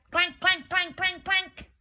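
quick high-pitched metallic clanks; clanking headless knight. Shown as a demonstration. Storyteller showing children the knight's clank, high clanking. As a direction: clank like a suit of armour. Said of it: "Can you do a clank?".

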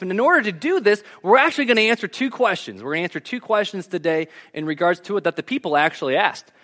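normal talking voice

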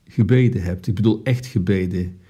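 ordinary talk

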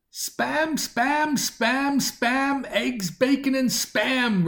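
high sing-song English chant of the spam list; sing-song English accent. A narrator's reading. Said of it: "still with the very recognizable English accent".

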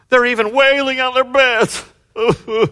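high, loud imitation wail; mock wailing. Preacher acts out people crying on their beds, mock wailing. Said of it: "They wail on their beds".

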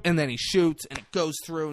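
ordinary talk

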